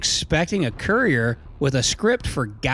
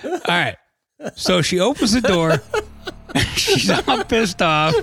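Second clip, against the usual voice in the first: trying not to laugh; 4 semitones higher than usual. Real, not acted. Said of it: "I'm trying not to laugh".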